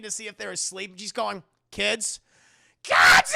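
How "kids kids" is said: screeching. Retold or imitated. Mother screeching to check if the kids are asleep. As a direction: screech the second call up into a shriek. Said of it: "It's just screeching".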